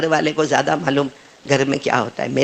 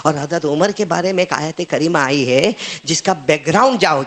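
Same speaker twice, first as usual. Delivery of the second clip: normal talking voice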